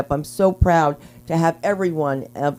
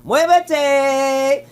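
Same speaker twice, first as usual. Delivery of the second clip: a lightly put-on Puerto Rican accent, higher pitch; mock Puerto Rican; 7 semitones higher, much slower than usual. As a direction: snap "muévete" like a New Yorker. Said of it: "I'll be like".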